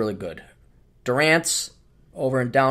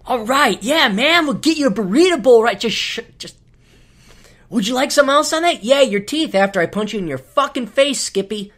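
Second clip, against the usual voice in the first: high, laid-back dude voice with a snap; cool casual server hiding contempt; hipstery server; 7 semitones higher, 4 dB louder than usual. Retold or imitated. Chipotle server faking casual cool, high laid-back voice. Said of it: "trying to be hipstery".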